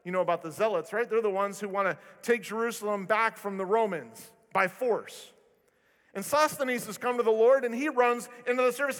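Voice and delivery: ordinary talk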